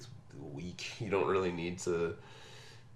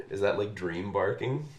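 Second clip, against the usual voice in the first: ordinary delivery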